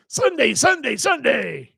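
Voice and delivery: very high, booming announcer Sunday chant; monster truck ad. Pretend. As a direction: hype it like a monster truck rally ad. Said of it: "be the monster truck guy".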